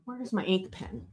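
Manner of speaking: softly muttering while searching for a pen; under-the-breath aside while hunting for the ink pen; quiet mutter to herself. Real, not acted. Searching for her pen mid-lesson.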